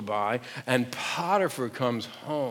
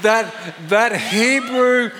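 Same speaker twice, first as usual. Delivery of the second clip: indignant wife; 7 semitones higher, 7 dB louder than usual. Shown as a demonstration. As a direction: accuse the Hebrew hillbilly with mock outrage. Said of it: "repeat after me".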